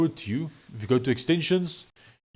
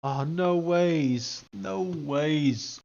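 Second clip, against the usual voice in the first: dismayed disbelief; 5 semitones higher, much slower than usual. Real, not acted. Dismay as code breaks.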